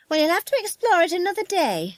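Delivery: high, insistent woman's voice; frightened woman. Acted. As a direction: overrule him in a high, fussy voice. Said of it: "said the woman".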